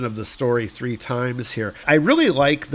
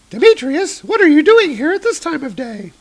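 put-on very high, louder young woman's voice; Hannah's voice; 6 dB louder than usual. Hannah opens the door to Demetrius, very high and surprised. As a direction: pitch way up as the surprised girlfriend.

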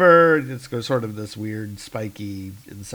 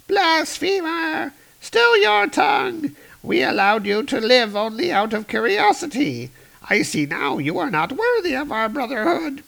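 very high, furious alien cry; enraged alien voice; 13 semitones higher than usual. Acted. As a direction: denounce the blasphemer.

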